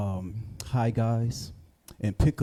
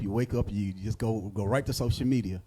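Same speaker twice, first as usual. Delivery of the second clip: ordinary delivery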